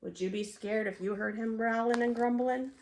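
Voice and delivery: usual speaking voice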